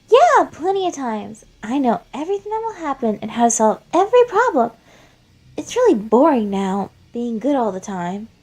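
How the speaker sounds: put-on high, loud childish voice, quick at first; a kid who's seen it all, rattling it off fast; high creepy child voice, loud and bored; Flowey, bored child. Flowey explains while strangling Asgore.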